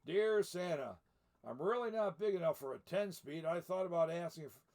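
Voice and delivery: lively read-aloud storybook voice; story narration. A narrator's reading. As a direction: read it like a picture book to children. Reads the book aloud.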